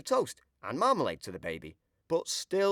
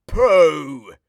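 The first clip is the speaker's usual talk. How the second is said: much louder "Pooh" at the smell; disgusted; 4 semitones higher, 12 dB louder than usual. A narrator's reading. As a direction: recoil from a dirty nappy. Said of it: "he says".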